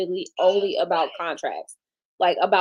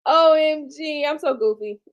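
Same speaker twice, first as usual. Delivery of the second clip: normal talking voice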